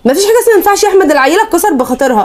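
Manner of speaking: wife protests to her husband about the holiday cookies, fast and high; heated protest. Acted. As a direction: cut him off and fire it back.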